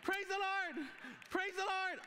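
high, loud repeated "Praise the Lord"; grudging loud praise. Retold or imitated. Forced to praise after each testimony, loud high shout. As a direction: shout praise you don't feel. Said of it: "I have to stand up after each one and go".

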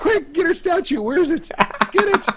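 high urgent shouting; frantic. Real, not acted. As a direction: rush the orders in panic. Urgent scramble after fall.

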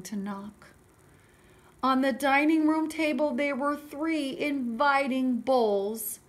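animated storybook narration; story reading for children. A narrator's reading. Teacher reading Goldilocks to children, animated storytelling. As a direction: read it out clearly to little listeners.

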